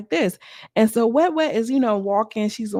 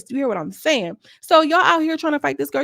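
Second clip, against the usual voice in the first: ordinary talk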